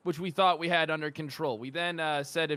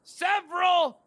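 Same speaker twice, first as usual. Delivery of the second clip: high, loud and slow insistence; emphatic shout; 6 dB louder, much slower than usual. Acted. Officer stressing the charges to the judge, slow shout. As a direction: drag it out and hammer it home. Officer hammering the point home.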